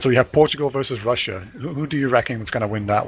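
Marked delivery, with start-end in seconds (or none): none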